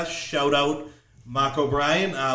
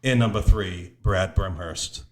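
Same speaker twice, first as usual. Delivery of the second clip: low, flat away-goal call; toned-down announcer; 6 semitones lower than usual. Shown as a demonstration. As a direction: read it out without excitement. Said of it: "you tone it down when it's the other team's goal".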